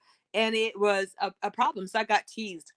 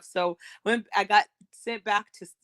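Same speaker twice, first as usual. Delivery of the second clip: normal talking voice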